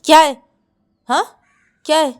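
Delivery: loud shouting after a shove; angry shout. Acted. Sister shoving her sister away, angry shout. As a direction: snap it out loud.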